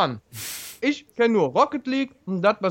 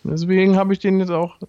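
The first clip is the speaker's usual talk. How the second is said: words round a mouthful; chewing while talking; 4 semitones higher, 3 dB louder than usual. Real, not acted. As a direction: talk while chewing pizza. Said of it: "ich esse grad eine Pizza".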